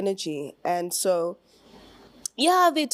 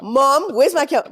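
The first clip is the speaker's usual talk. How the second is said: calling out to mom loudly; yelling across the room; 7 semitones higher, 8 dB louder, slower than usual. Pretend. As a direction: call to mom in another room. Calling out to mother.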